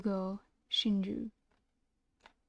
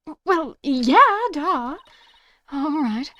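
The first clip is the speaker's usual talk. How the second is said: flustered; 6 semitones higher, 10 dB louder, slower than usual. Acted. Flustered attorney reaction.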